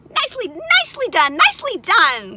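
high, loud cheering goose; excited high-pitched praise; goose crying praise. A narrator's reading. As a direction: cheer him on in a goose squawk. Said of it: "cried the goose".

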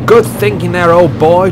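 high, loud, jubilant praise; cheering. A narrator's reading. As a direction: cheer on a trusty friend. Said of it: "cheered the driver".

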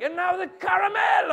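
put-on loud, high German-accented bellow; yelling German chef. German chef announcing the next step, yelled. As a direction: yell the order as a German chef. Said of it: "As the German chef yells orders".

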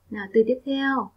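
normal talking voice

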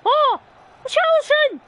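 high, loud yell at a player; real outburst at the match; exasperated shout. Real, not acted. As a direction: shout the name in protest. Yelling at his player.

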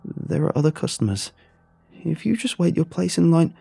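timid whimper. Acted. A scared cashier pleading with the monster to wait in line. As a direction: plead meekly. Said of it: "Teddy whimpered".

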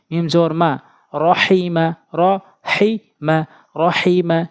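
ordinary talk

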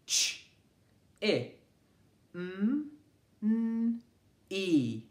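Ch- i m- n- e, each sound said alone and clipped apart; a phonics teacher segmenting for children; segmenting phonemes. Shown as a demonstration. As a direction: sound out "Chimney" one phoneme at a time. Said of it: "Segment this word with me".

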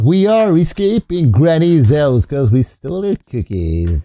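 usual speaking voice

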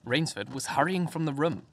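ordinary delivery, nothing marked

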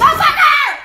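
loud startled shriek; frightened scream. Real, not acted. Scared again, yelling.

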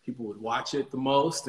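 ordinary talk, nothing marked

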